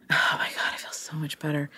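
answering a friend in a relieved whisper. Real, not acted. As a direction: breathe it out in a whisper. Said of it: "You feel better?".

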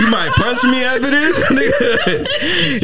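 high, laughing heads-up; laughing honest warning. Retold or imitated. Honest friend warning of bad news, laughing. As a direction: warn through laughter. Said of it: "no matter if they know".

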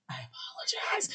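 whispered apology; whispering. Real, not acted. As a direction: whisper sheepishly. Apology for mispronouncing names.

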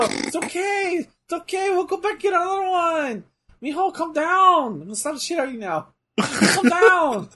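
hammed-up uncle calming the kid; mock soothing. Voicing Eddie calming Chavo.

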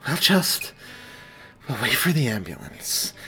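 mildly winded, halting delivery; recovering from a wave of pain; panting. A narrator's reading. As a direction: break it up as if catching breath. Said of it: "she panted before finishing".

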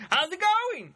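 high-pitched mock holler; imitated cheery greeting. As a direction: call out a hammed-up hello. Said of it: "that's when he's like".

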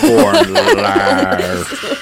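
drawn-out, very slow teasing delivery; mocking the mom's old saying slowly. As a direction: ham up the corny saying. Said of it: "You're all".

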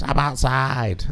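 high, drawn-out mock girly voice; slow, sing-song impression of the girls; imitating the girls. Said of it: "We don't sound like that".

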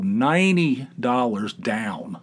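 "ninety" dragged long, falling away on "down"; drawn-out emphasis. Real, not acted. Gambler totting up his losses, "ninety dollars down" drawn out. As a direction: stretch out "ninety dollars down" slowly.

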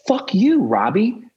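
snapping at the sponsor, mock-angry; retorting curse. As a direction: bite back at him, half-joking.

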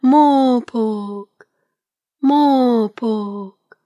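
long, drawn-out falling hoot; owl call. A narrator's reading. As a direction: hoot "Mawpork" slowly, letting it slide down. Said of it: "He called out".

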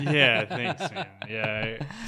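choked up with tears; teary, laughing through it. Real, not acted. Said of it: "sorry, I got a little teary".

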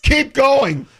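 high, loud eager urging; the manager pushing him on, thrilled; excited urging. Retold or imitated. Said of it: "what he said".

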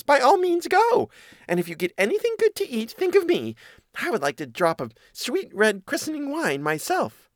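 very high little mouse voice; agreeable mouse. Acted. Mouse sending the cat off to the christening, very high and agreeable. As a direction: chirp it up high as an obliging mouse.

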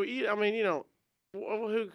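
ordinary talk, nothing marked

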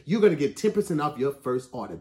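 ordinary delivery

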